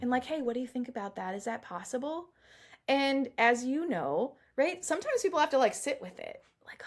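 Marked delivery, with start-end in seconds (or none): 0.2-2.3 soft, quick tentative question; really gentle. Acted. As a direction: gently check in with a client. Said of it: "we say it really gently".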